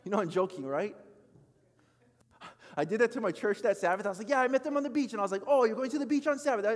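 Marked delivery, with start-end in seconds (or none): 4.3-6.7 higher, louder, hammed-up accusation; mock accusing. Pastor mimicking his church scolding beachgoers, mock accusing. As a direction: play the judgy churchgoer, half joking.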